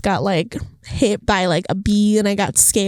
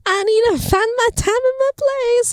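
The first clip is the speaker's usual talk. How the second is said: whiny self-mockery; 14 semitones higher than usual. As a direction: whine it like a lost kid, much higher. Mock whiny quote continues.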